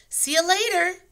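high, loud call across the yard. A narrator's reading. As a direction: call it after them. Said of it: "Annie called".

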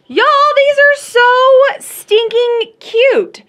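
high, loud, slow gush; gushing delight. Real, not acted. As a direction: squeal over something adorable. Gushing over kitchen cloths.

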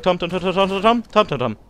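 rapid-fire string of calls; repeated name calling. Real, not acted. Vlogger checking if Tom's back, repeated calling. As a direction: pester a missing friend with the name.

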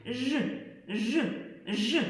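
ж drawn out as a long buzzing zh; buzzing zh demo. Shown as a demonstration. Teacher shows children the sound, long buzzing ж. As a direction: buzz the sound ж between the teeth. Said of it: "і каже".

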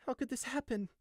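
high, stunned question; numb shock. A narrator's reading. Sheep stares at the body, numbly asking. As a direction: ask it from inside the shock. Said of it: "he asked numbly".

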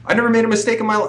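acting out a fast, high-pitched denial; defensive denial. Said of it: "the other way to go about it would be like".

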